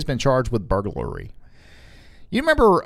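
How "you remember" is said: high excited opener; sudden high pitch. Real, not acted. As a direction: jump way up in pitch on this.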